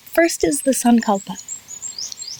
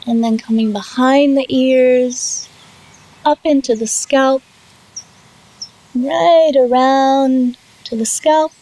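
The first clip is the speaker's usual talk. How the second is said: guided meditation. Real, not acted. Yoga teacher leading students through settling and self-massage, guided meditation. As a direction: draw out key words, long pauses between short phrases. Leads guided relaxation practice.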